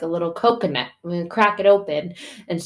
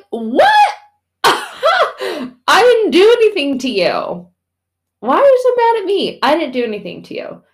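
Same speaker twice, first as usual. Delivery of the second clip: loud, high-pitched, hammed-up protest of innocence; mock hurt disbelief; 12 semitones higher, 10 dB louder than usual. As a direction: squeal it in comic disbelief with a laugh. Acts out God hurt by our blame.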